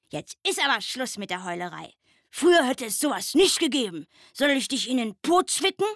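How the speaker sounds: fast, lightly voiced stern stag beetle; stern old beetle. A narrator's reading. Old stag beetle scolding the crying owlet, mildly stern and quick. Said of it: "sagte streng".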